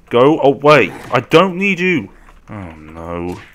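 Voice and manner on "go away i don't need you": loud, emphatic rebuff; shouting off attackers. Real, not acted. Player facing an angry mob, shouting it away. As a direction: bellow it at the pursuers.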